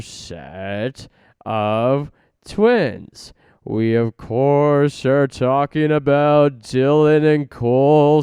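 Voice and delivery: slow, loud, big announcer read; booming announcer voice. Acted. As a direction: announce it like a TV host.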